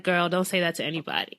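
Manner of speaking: Jamaican mom scolding, low and soft; Jamaican accent. Retold or imitated. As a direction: warn her off in a Jamaican accent. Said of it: "In a Jamaican accent".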